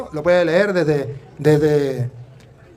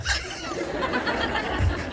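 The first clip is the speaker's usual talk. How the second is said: breathy laugh; 10 dB quieter than usual. Real, not acted. Laughs at own retold line.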